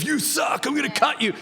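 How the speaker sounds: hammed-up movie heckler; lightly acted angry heckler; aggressive heckler. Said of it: "it's always that aggressive".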